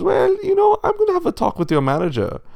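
high, loud, fast threat; huffy rich pig. A narrator's reading. Pig threatens to call the wolf's manager, huffy.

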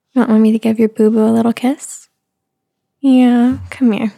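ordinary delivery

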